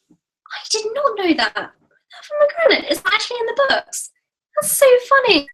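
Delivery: high, squealing delight at a fun fact; delighted surprise. Real, not acted. Fan hearing the Italian name, high delighted surprise. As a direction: gush with surprise, then laugh it off.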